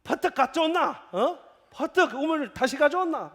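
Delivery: brusque, loud, high Gyeongsang dialect demand. Retold or imitated. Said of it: "경상도". (경상도 사투리로 크고 높게 다그치기)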